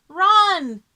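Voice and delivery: high, loud yell of run. Acted. Sally yells at Joe to flee the dinosaur, loud. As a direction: scream at your brother to flee. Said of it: "yelled Sally".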